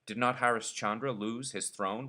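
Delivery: plain reading voice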